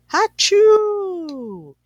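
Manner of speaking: loud high hammed-up sneeze; big sneeze. Bear woken by pepper, huge loud sneeze. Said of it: "He blows and he sneezes".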